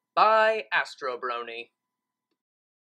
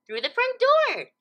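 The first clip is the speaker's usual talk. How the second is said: high, slow bubbly Pinkie voice; as if it's obvious; Pinkie, matter-of-fact; 7 dB louder, slower than usual. Acted. Pinkie tells Twilight she simply used the door, high and slow. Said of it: "Pinkie replied, as if the answer was obvious".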